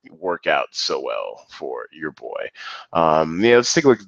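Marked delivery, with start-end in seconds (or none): 0.1-2.5 low, slow and soft, deflated; glum letdown. Real, not acted. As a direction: sigh it out like a beaten man. Self-mocking about his failed strategy.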